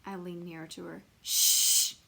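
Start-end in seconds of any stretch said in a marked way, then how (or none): 1.2-1.9 sharp unvoiced shush. Junie B. shushes May, a sharp unvoiced shush. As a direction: hiss it out to hush her. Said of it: "I said".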